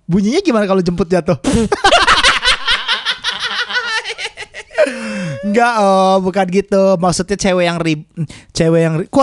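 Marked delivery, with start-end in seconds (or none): 1.4-5.4 high shouting laugh. Real, not acted. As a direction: roar with laughter. Big laugh at joke.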